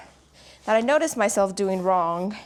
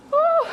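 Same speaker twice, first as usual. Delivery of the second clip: high whoop; 6 dB louder than usual. Real, not acted. Relief after set.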